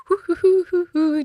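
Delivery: high, light string of hoots; imitated whoosh. Storyteller mimics dashing to her parents' house, high hooting. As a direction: hoot along airily as a travel sound effect. Comic noise of rushing off.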